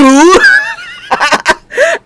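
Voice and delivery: very high, loud laughter. Real, not acted. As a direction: burst out laughing. Cracks up at own answer.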